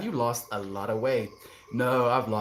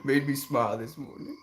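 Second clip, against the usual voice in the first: usual speaking voice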